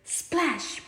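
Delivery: ordinary delivery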